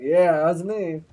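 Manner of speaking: loud, slow, dopey-proud imitation; mock proud agreement. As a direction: agree loudly like an eager dog. Voicing Colton as eager dog.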